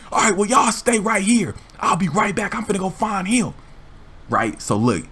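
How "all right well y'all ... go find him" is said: higher mock bossy voice; driver ordering. Driver telling the kids to wait, higher mock orders. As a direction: order them to stay put.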